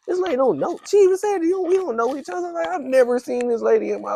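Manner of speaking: incredulous, climbing high; amazed. Real, not acted. Still stunned telling his friend the stranger never knew him, high excited.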